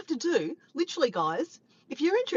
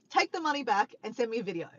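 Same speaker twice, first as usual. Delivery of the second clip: ordinary delivery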